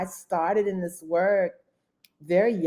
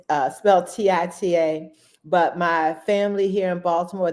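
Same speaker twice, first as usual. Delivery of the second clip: ordinary talk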